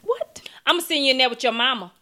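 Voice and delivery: loud, high, fast threat; threatening to send the dog to her mom; yelling at the dog. Real, not acted. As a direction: snap at the dog.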